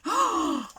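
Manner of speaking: sharp high gasp. Acted. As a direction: gasp in fright. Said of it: "she gasped".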